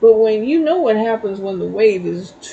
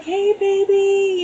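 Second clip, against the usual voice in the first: high, slow cooing greeting; sweet baby talk; 9 semitones higher, slower than usual. Retold or imitated. Cooing high and slow at the groundhog in the backyard. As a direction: coo it high and slow like calling a pet.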